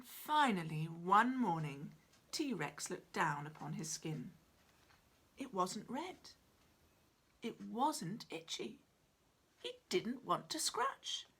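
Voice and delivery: ordinary delivery, nothing marked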